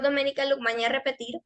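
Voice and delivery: ordinary talk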